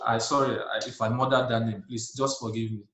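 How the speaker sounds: usual speaking voice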